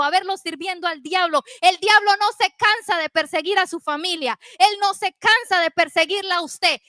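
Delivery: forceful, insistent exhortation with punched words; rally the congregation to battle; urgent pulpit exhortation; fired-up preaching. Real, not acted.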